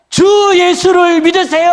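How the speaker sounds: loud, high street preacher cry; mock shout. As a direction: belt it out like a subway evangelist. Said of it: "고래고래 소리를 질러요". (고래고래 외치는 흉내)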